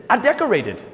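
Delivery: put-on high little-kid voice; put-on cute child's voice; cute five-year-old. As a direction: play a small boy proudly explaining. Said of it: "in a cute little five-year-old voice".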